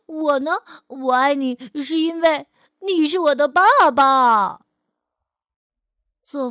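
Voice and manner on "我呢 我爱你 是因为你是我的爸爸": put-on louder, higher joyful child voice; happily. A narrator's reading. Fei'er answering his father, loud and overjoyed. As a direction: beam back at dad with delight. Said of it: "菲儿高兴地接着说".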